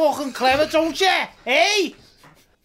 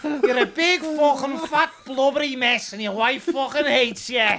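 jeering Liverpool bloke hurling insults; very angry Scouser. Retold or imitated. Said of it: "impersonating a very angry Scouser".

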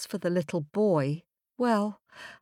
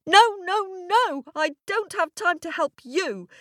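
put-on high, indignant child refusal; stamping little girl; 11 semitones higher, 4 dB louder than usual. A narrator's reading. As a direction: protest loudly at first, like a foot-stamping child. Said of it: "stamping her foot".

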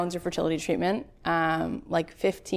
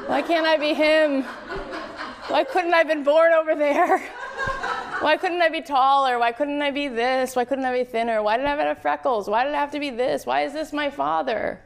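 mock whining; 7 semitones higher than usual. Mocking childish self-pity.

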